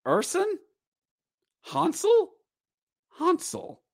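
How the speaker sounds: high questioning names; mock puzzled. Real, not acted. Vlogger unsure which goalie starts, puzzled questioning. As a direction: pretend confusion over the goalie. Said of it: "mock confusion over goalie".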